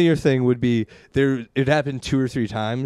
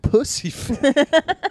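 laughing; much slower than usual. Retold or imitated. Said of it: "These people just whispered".

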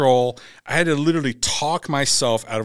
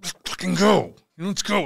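high, strained, raised challenge; mad battle cry; 8 semitones higher, 4 dB louder than usual. Retold or imitated. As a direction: dare them on, retelling your fury. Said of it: "I'm so mad".

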